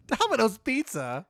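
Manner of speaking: slow, high, loud through laughter; laughing disbelief. Real, not acted. Player hearing what the butler cooks, incredulous and laughing. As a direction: question it while cracking up.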